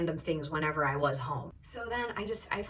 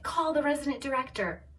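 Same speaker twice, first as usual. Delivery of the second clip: high-pitched retold demand; mock screaming; 7 semitones higher than usual. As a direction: imitate the mother's yelled order, not full volume. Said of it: "her mom is screaming".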